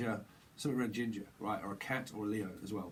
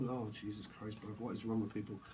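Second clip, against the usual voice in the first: ordinary talk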